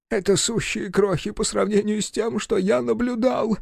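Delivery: high, plaintive professor voice; tearful whimper. A narrator's reading. Professor lamenting the specimens he saw, tearful. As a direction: hold it high, on the edge of sobbing. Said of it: "всхлипнул профессор".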